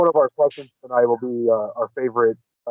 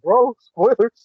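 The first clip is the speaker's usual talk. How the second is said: high-pitched; 10 semitones higher, much slower than usual. Retold or imitated. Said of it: "will say that to each other".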